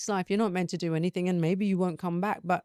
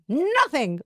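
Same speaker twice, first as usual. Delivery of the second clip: higher-pitched mock-petulant answer; acted-out cross reply; sulky denial; 6 semitones higher, 4 dB louder than usual. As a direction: answer back in a put-on huff. Annoyed answer to higher self.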